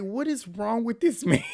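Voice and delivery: high-pitched, amused exasperation; laughing disbelief. Real, not acted. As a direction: ask it through laughter, baffled by him. Laughing disbelief at his behavior.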